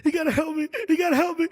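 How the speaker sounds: high, loud desperate plea; panicked caller. Retold or imitated. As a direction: beg the vet for help. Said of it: "The guy's crying".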